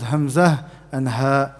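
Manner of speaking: hamzah and ha drawn out slowly from the bottom of the throat; slow throat letters. Shown as a demonstration. Teacher slowly demonstrating the deepest throat letters. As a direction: breathe them out slowly. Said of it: "bottom, you have two letters".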